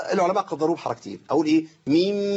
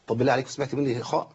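usual speaking voice

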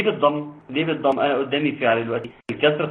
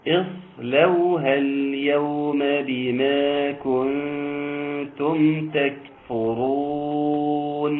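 slow, melodic tajweed chant; teacher chanting the verse with full elongations; Quranic recitation; much slower than usual. Acted. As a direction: draw each vowel out in a measured chant.

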